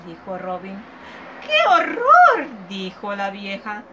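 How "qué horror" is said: much higher, much louder, slow shriek of disgust; old woman horrified at last. Acted. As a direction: pull out all the stops, totally appalled.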